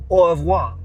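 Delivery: "Au revoir" blurted loud and high in a mocking French accent. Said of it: "I don't even know how it's French".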